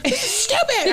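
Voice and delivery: high, indignant outburst, half laughing; a mock rant, up high and laughing; outraged. Mother fumes that the rodeo closed, high and indignant. Retold anger at rodeo closing.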